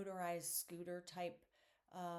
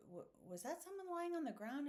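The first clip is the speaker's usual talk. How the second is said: high, quick mock-startled query; stammered start, pitch leaping up; startled question; 10 semitones higher, faster than usual. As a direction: imitate an alarmed passenger's double take. Voices wife's startled question.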